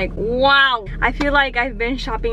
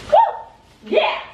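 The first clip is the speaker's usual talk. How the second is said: high, loud whoop; cheering; 6 dB louder than usual. Real, not acted. Ready to decorate for fall, loud cheering whoop. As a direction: whoop it out with joy.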